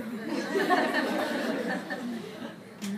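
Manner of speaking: amused laugh at her own joke; real laugh. Real, not acted. Teacher laughing at her mother-in-law joke with the girls. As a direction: let a laugh slip out after the joke.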